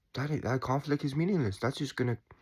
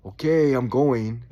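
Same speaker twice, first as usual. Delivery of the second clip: louder, slow, bracing; resolved declaration; 8 dB louder, slower than usual. Retold or imitated. Soul about to arrive on Earth, loud and resolute. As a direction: brace and commit, loud and slow. Souls deciding to come to Earth.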